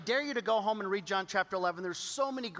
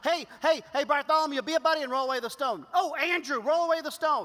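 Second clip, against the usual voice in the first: raised, louder mock summons; demonstrating a higher, louder call; 6 semitones higher, 6 dB louder than usual. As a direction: call over a buddy, exasperatedly hammed up. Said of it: "How come you couldn't have just gone".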